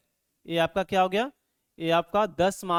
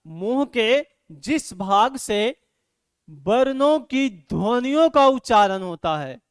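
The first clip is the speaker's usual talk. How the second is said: slow, louder, every word spelled out for the class; slow dictation; 3 dB louder, slower than usual. Shown as a demonstration. Teacher to students, dictating the definition slowly and loudly.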